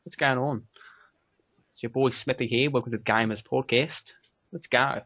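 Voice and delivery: put-on Australian accent. Shown as a demonstration. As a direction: show off a casual Australian accent. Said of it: "I can do a reasonably good, uh, Australian accent".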